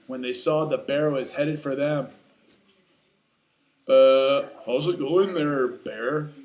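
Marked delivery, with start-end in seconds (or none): 3.9-6.3 loud hesitant opener, uneasy greeting; nervous moose. Acted. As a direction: stall with a long uh, then greet. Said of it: "asked Took a little nervously".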